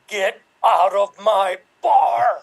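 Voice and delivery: slow, drawn-out shout, word by word; angry movie-hero order. Acted. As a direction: throw them out with a slow, forceful bellow. Bartender throws them out, movie line.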